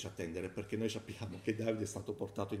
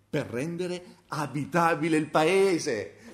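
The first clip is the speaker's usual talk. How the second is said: slow, raised solemn scripture reading; solenne lettura biblica; 4 semitones higher, 6 dB louder, slower than usual. Acted. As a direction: proclaim the verse slowly and loud. Reads Isaiah verse aloud. (lettura solenne e lenta del versetto)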